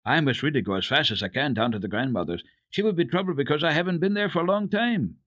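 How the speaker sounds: fast, rushing words; hurried. A narrator's reading. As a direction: hurry to get away. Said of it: "said hurriedly".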